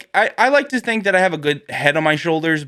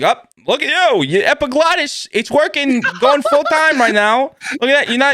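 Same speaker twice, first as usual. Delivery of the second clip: high, excited cheering on a diner; high-pitched, over-delighted busybody; nosy pizza-shop regular; 9 semitones higher than usual. Acted. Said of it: "I hope to one day be that guy".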